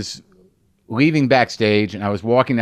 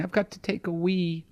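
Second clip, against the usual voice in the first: hushed, higher British "take a wee"; a dainty British grown-up, lightly mocked; quiet mock British voice; 4 semitones higher, 6 dB quieter than usual. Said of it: "It's just people saying".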